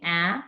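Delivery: "ah" said open and short, like the a in apple; short a sound. Shown as a demonstration. Teacher showing the class the short A, a clear "ah". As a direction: give the pure short "ah" of apple. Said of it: "if the A is saying".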